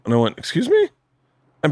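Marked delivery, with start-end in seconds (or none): 0.4-0.9 high, rising incredulous question; retold offended disbelief, pitched way up; taken aback. Husband answering wife's criticism, taken aback.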